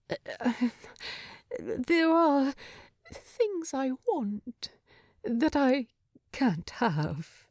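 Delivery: faltering, broken into pieces; halting confession. Acted. Bilbo admitting what he wants to Thorin, a mild halting falter. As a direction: struggle to get each phrase out. Bilbo struggling for his words.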